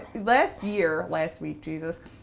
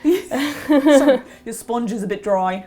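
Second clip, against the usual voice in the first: stern British judge; dry, critical British baker; Paul Hollywood; 4 semitones higher, 8 dB louder, slower than usual. Pretend. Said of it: "be like Paul Hollywood".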